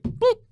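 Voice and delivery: tiny high-pitched "boop"; imitated lock noise. Son miming dad quickly locking his truck door, high boop. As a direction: pop out a high little sound effect. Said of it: "He was like".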